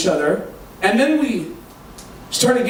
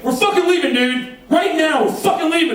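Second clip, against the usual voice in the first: mock angry yell, much higher; retold yelling; 8 semitones higher than usual. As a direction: bark it at him, showing off. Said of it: "he starts yelling at me".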